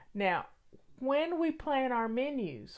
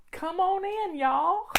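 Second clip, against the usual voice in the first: Southern drawl; 8 semitones higher, slower than usual. Shown as a demonstration. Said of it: "I can even get more Southern".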